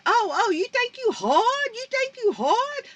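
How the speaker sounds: high, taunting imitation of the guy, pitched well up; mocking the guy. Mother retelling to viewers how the guy taunted her, high mocking voice. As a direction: sneer it up high like the man who confronted her. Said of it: "And he said".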